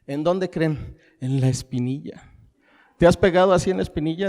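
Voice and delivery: normal talking voice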